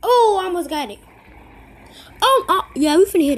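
thrilled at almost scoring; excited shouting. Real, not acted. Excited near goal.

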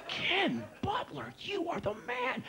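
awed praise. Retold or imitated. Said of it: "I was like".

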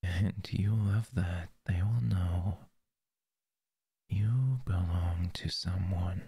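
breathy close-mic whisper. Acted. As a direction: whisper it right into the ear. Whispered roleplay.

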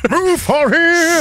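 slow, high, laughing; laughing impression. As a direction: drawl it out through laughter. Imitating fan.

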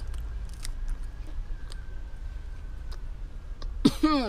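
raspy, breathless cough from the pepper; spicy coughing fit. Real, not acted. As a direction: cough hard, choking on the heat. Eating spicy bun and cheese.

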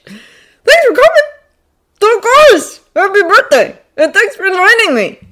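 put-on high, loud kid voices; eager, high-pitched politeness; excited greetings. A narrator's reading. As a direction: chirp it out like happy boys. Two excited boys greet each other.

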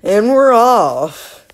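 slow, loud drawn-out launch; hammed-up and we're off; announcer kickoff. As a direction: call it like a race start.

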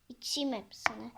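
a sly whispered aside. Acted. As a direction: say it secretly to yourself. Sneaky aside.

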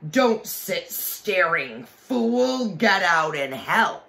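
furious, high, slow shouted order; angry shout. A narrator's reading. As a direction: bark the order like a cruel witch. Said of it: "for the witch said".

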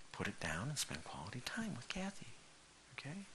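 soft whisper, urging; whispered advice. Real, not acted. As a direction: whisper it like a secret tip. Whispered exhortation.

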